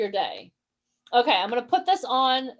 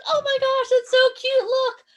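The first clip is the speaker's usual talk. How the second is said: put-on high, delighted gushing over a baby; loud, high doting parent praise; gushing mom; 11 semitones higher, 4 dB louder than usual. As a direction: coo with excitement at a first step. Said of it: "She's gonna".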